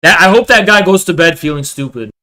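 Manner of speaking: usual speaking voice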